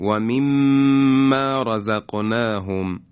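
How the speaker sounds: و مما رزقناهم with the doubled mim held through the nose; nasal ghunna demo. Shown as a demonstration. Teacher showing the doubled mim. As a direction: hum the mim in مما slowly. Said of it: "در فضای بینی هست".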